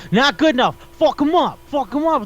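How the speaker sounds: high-pitched, hyped-up egging on; mock urging shout. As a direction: imitate someone yelling to keep attacking. Coach's harsh urging, acted retelling.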